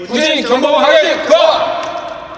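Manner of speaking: loud echoed safety call; shouted check call. Real, not acted. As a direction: bark back the checklist item.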